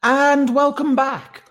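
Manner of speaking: high, slow loud show host; announcer voice. Acted. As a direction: welcome everyone back like a host.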